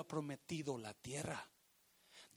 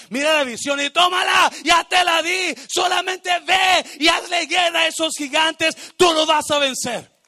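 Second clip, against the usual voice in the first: very loud, high commanding shout; shouting God's command; 13 semitones higher, 22 dB louder than usual. Acted. God urging the believer to fight giants, shouted. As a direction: roar it as a battle order. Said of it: "Y a veces Dios te dice".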